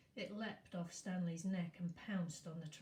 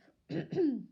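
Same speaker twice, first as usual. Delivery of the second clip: throat clearing; 8 semitones higher, 12 dB louder than usual. Real, not acted.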